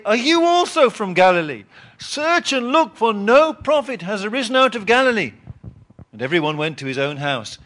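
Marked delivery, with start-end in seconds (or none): 0.0-5.3 loud, higher mocking retort; scornful Pharisees. Pharisees mocking Nicodemus, loud and scornful. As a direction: sneer it back at him. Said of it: "And they say".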